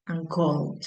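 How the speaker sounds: called, ending in D; English called. Shown as a demonstration. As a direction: say called with a final D. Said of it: "por ejemplo".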